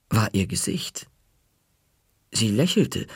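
plain reading voice

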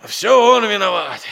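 loud, high outburst of grievance; angry blame. Acted. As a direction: burst out accusingly against the master. Servant blames his absent master.

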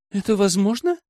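lifted, rising question; surprised. A narrator's reading. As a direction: ask in mild astonishment. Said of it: "удивился я".

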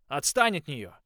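loud protective shout. A narrator's reading. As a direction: yell it to drive him off. Defending Holly, shouting at wolf.

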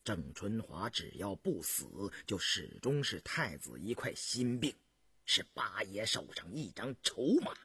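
low, hushed, rueful counsel; sighing. A narrator's reading. Adviser to his prince on Zheng Chunhua's danger, sighing. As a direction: speak low and soft, as after a sigh. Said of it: "乌思道巍然一叹".

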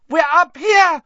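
very high, loud scream for help. A narrator's reading. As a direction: scream to be found. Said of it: "Hermione screamed suddenly".

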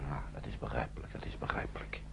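hushed whisper, barely voiced; whispered aside. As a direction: whisper it so the boy can't hear. Aside after the boy's outburst.

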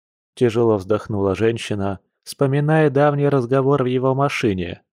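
usual speaking voice

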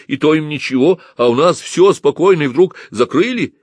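high, loud, heated argument; indignant protest. A narrator's reading. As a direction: argue hotly against an injustice. Youth protests the club's closure.

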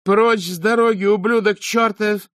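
high, loud, furious yell; screaming abuse at a stranger; angry shout. Acted. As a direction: shove him aside with your voice. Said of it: "крикнула Шаяна".

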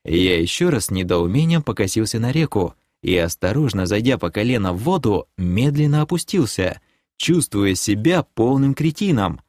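plain reading voice

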